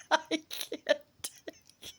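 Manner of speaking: high helpless laughter, words breaking through; cracking up, can barely speak; laughing fit. Real, not acted. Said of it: "I apologize for the laughter".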